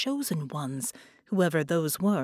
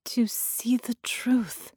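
breathless whisper; 5 semitones higher, slower than usual. Acted.

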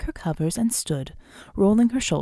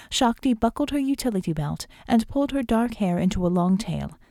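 usual speaking voice

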